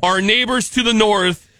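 normal talking voice

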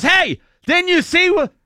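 high, loud, slow mock challenge; retelling the driver confronting the stranger; angry confrontation. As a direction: call him out, slow and huffy.

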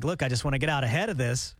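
ordinary talk, nothing marked

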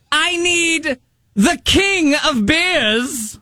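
high, slow grand herald voice; town crier voice. Acted. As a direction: declaim the thirst like a medieval crier. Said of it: "in the town crier voice".

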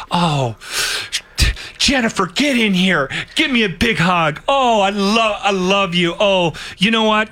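gushing high-pitched fake greeting; mock someone overdoing love for a coworker; put-on sweetness, pitched well up; fake affection. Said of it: "when people are fake".

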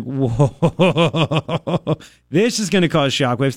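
retold reaction with a laugh; laughing whoa. Host retelling his Friday reaction, laughing. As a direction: chuckle through the line.

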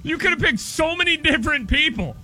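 ordinary talk, nothing marked

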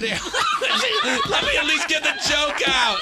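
laughing. Real, not acted. Laughing while trying to tell joke.

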